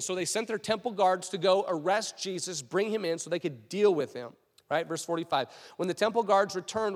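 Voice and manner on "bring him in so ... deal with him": ordinary talk, nothing marked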